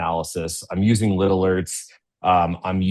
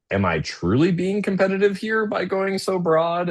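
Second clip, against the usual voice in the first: much higher, questioning voice of a doubtful store owner; inner question voiced; 12 semitones higher than usual. Presenter voicing a store owner doubting the plan, high and questioning. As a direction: play a store owner second-guessing himself, pitched up.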